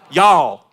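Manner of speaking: "y'all" said as one loud falling syllable, yawl; a loud drawn-out Southern "y'all". Shown as a demonstration. Pastor teaching congregation the Southern plural, loud "y'all". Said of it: "second person plural in the South".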